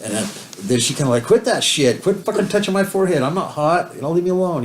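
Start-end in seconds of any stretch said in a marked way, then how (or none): 1.2-4.6 higher-pitched mock scolding, imitating her; irritated snapping. She tells him off for touching her forehead, irritated mock snap. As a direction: snap back at him to quit touching you. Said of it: "when she's chewing my ass".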